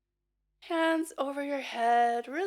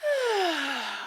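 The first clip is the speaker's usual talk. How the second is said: demo breath sigh; 4 semitones higher than usual. Shown as a demonstration. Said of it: "Breathe in".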